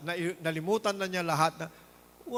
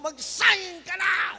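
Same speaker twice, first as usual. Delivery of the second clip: high, slow, drawn-out shout; mock yelling spouse; 11 semitones higher, 5 dB louder, much slower than usual. Spouse shouting at husband to sign, mock high shout. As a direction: bark the order like a nagging spouse. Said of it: "Pag sinigawan ka ng asawa mo".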